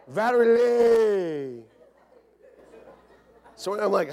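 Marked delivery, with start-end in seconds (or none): 0.1-1.6 loud, high, long-stretched Southern Lee; hammed-up Southern drawl. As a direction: belt the name out with a Southern drawl. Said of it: "People from the South, they don't, when they talk".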